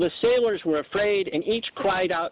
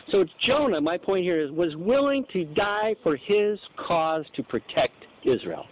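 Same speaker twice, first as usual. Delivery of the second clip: normal talking voice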